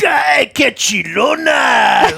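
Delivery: high, loud, drawn-out holler; shout out the window. Retold or imitated. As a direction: imitate Uncle Carlos hollering from a moving car. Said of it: "stick his head out the window".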